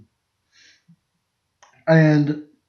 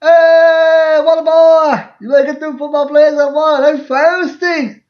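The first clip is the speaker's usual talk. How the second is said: much higher, loud hollering call; dad yelling from stands; 10 semitones higher, 4 dB louder than usual. Retold or imitated. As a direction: holler across the stands at your son. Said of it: "you could hear him up in the stands".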